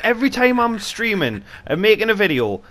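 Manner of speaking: normal talking voice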